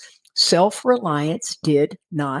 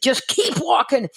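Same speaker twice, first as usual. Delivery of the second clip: urging on; 8 semitones higher than usual. Retold or imitated. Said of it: "he started yelling at me".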